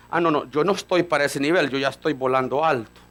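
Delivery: smug believer. Retold or imitated. Mimics believer with superiority complex.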